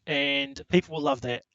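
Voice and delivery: ordinary delivery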